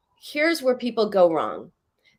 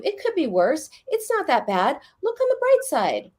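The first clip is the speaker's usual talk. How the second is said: higher, breezy brush-off; dismissive comfort; 7 semitones higher than usual. Retold or imitated. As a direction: chirp the bright side lightly. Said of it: "someone tells you".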